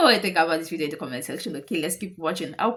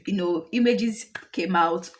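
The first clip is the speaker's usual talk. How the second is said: ordinary talk, nothing marked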